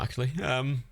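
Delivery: usual speaking voice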